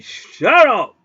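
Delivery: loud, high yell at the dogs; angry yell. Retold or imitated. Man hollering at noisy caged dogs, angry yell. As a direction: yell it with full force.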